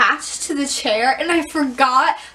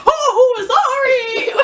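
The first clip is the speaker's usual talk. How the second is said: high, loud mock apology; exclaimed, pitched-up sorry; apologetic guy; 9 semitones higher, 5 dB louder, much slower than usual. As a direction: imitate a flustered guy apologizing. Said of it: "the guy was like".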